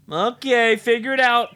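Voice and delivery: high, loud imitated dismissal; breezy brush-off. Boyfriend away from home hearing of the mouse, dismissive.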